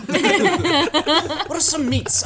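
slow, high laughing question. Real, not acted. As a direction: squeal it out through laughter. Laughing at own joke.

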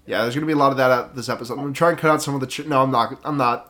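usual speaking voice

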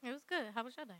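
very soft, low acted reply; playing innocent. Girlfriend answers boyfriend in role-play, hushed and innocent.

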